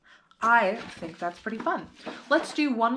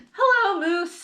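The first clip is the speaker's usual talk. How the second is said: high, loud greeting; happy hello; 11 semitones higher, 5 dB louder than usual. A narrator's reading. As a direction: call hello warmly. Girl greets her dog.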